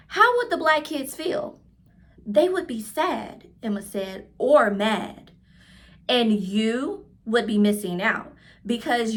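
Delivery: clear, lively read-aloud voice for a class; reading to children. A narrator's reading. Teacher reading a picture book aloud to her class, lively storytelling. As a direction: tell the story warmly to young listeners.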